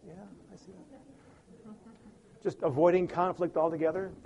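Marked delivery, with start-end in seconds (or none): 0.1-1.7 placating relative. Retold or imitated. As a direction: murmur along just to avoid conflict.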